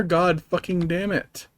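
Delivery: ordinary talk, nothing marked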